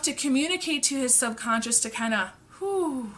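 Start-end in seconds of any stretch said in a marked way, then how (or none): 2.6-3.1 relieved "whew", breathed out; calming exhale. Acted. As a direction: let out a soft, calming breath.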